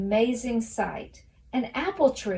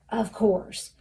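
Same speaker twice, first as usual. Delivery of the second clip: usual speaking voice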